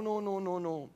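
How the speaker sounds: ordinary delivery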